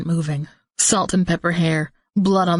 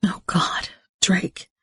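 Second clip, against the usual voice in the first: whispered dread; 2 semitones higher, 3 dB quieter, slower than usual. A narrator's reading. Dread on seeing the gurney.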